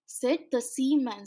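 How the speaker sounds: plain reading voice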